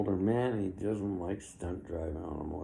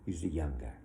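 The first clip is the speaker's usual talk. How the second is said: ordinary delivery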